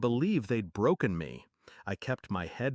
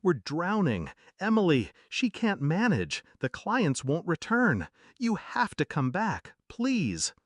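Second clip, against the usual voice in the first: high, urgent, desperate plea; frantic, broken; 7 semitones higher than usual. A narrator's reading. Ruined father phones his child for help, frantic and broken. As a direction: beg frantically in a raised pitch. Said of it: "His voice was frantic, broken".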